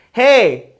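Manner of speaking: loud attention-grabbing hey; calling out. Acted. Imagined person interrupting the president about Puerto Rico, calling out. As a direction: call out sharply to interrupt.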